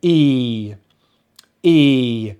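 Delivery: long front EE, slowly falling; long vowel demo. Shown as a demonstration. Teacher modelling the front vowel, long falling EE. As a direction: sustain "E" from the front of the mouth. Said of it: "It comes from the front of the mouth".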